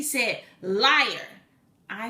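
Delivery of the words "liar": loud, drawn-out retold accusation; mock angry accusation. Scammer calling her a liar, loud mock accusation. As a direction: throw the word out in mock outrage. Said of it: "angry and antagonistic and fussing".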